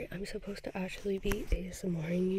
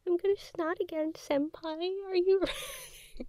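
put-on high squeaky anime girl voice to senpai; cutesy anime girl; 11 semitones higher, 5 dB louder than usual. Whispering sick ASMR to the viewer, cutesy anime girl voice. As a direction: play a bubbly anime schoolgirl teasing her senpai.

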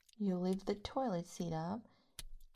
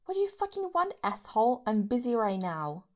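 angry snap; 7 dB louder than usual. Acted. Snapping at the partner who walked in, loudly angry. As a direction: bite their head off for interrupting.

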